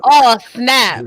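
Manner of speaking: ordinary talk, nothing marked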